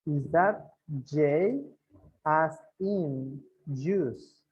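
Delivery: very slow, each word drawn out; slow model phrase. Shown as a demonstration. Teacher showing the phrase slowly on the board. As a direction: stretch it out so learners hear every word. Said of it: "Entonces aquí le pongo, miren".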